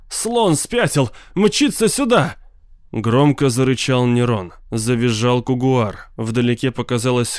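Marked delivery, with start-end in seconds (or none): 0.1-2.4 high, drawn-out cry on the run; panicked shout. A narrator's reading. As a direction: call out a frantic warning, pitched high. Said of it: "На бегу он крикнул". (крик на бегу, высоким голосом)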